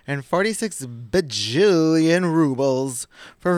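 loud, drawn-out bajillion; mock-grand exaggeration. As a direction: blow up the absurd number. Mocks the absurd fine amount.

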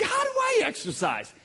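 high, loud cry of a question; pleading shout. Retold or imitated. Man asking the Lord for guidance, pleading shout. Repeating his plea to God.

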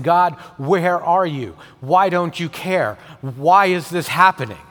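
David pleading loudly and slowly to God; crying out to God. Retold or imitated. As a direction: cry out to God in distress. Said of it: "crying out to God".

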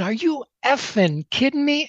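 high, disbelieving cry of shock; blurting out in stunned disbelief; retold outburst. To the seminar speaker, a retold incredulous outburst.

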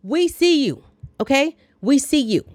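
high, loud sing-song taunt; mock taunting. Vlogger mocking Earl's honking, high loud taunt. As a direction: tease him back, high and loud.

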